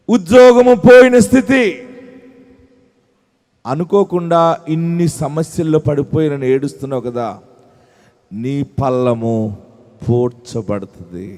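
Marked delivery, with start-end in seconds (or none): 3.7-11.3 tender comfort. Real, not acted. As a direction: ease the words down gently to someone in tears. Comforting a grieving listener. (మృదువుగా, నెమ్మదిగా ఓదార్పు)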